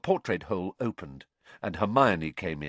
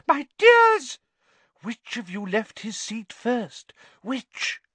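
very high, alarmed shriek, then slower questioning; loud shriek; 7 dB louder, slower than usual. A narrator's reading. Seer alarmed at who left the table first, shrieking. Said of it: "she shrieked loudly".

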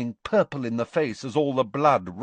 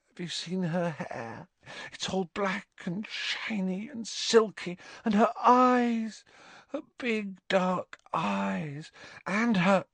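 high, dreamy, drawn-out adoration; lovesick swooning; 9 semitones higher, 3 dB quieter than usual. A narrator's reading. Ron raves to Harry about her, swooning. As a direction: gush softly, as if in a trance.

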